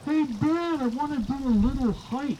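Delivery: high chirpy daughter on phone. Retold or imitated. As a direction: chirp it like his grown daughter.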